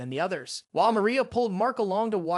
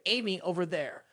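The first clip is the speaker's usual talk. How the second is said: usual speaking voice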